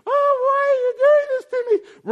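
preacher mimicking a whining believer; mock wail. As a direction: wail it like a self-pitying sufferer. Sufferer's complaint voiced mid-sermon.

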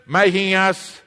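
normal talking voice

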